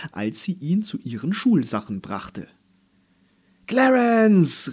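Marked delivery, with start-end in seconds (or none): 3.7-4.7 high, loud cheer of a name; jubilant call. A narrator's reading. Toys greeting their returning friend, jubilant call. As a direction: cry out the name in welcome. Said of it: "riefen Patsy und Ellie und Minka und Konrad".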